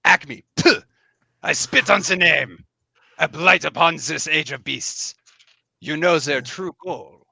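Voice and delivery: put-on scornful French-accented mouse knight; theatrical contempt in a French accent; French lilt. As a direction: spit out the name in a French-tinged accent. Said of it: "a little bit of a French lilt".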